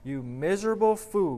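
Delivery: storyteller's pained demon retort; agonized gasp. A narrator's reading. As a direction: spit it out as a tortured demon, narrated. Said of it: "gasped the agonized, tortured demon".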